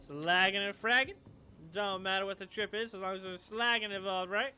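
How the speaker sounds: loud, eager sales pitch with rising excitement; pushy street hustler. Acted. Clocker pitches crime trips to the runners, loud and eager. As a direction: sell it hard like a twitchy dealer.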